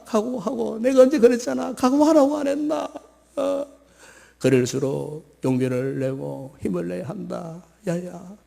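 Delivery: steady an upset daughter like a seasoned mom; elderly mother comforting her crying daughter; consoling old mother. Acted. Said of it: "엄마가 더 듣고 있다".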